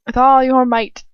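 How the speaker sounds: loud taunting villain, leaning on all; a cruel tormentor pressing his captive. Acted. Villain taunt, repeated on next take.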